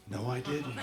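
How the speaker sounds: low, very soft mock denial; small, low voice playing innocent; hushed denial. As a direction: drop to almost nothing and deny it. Prankster denies it to the cop.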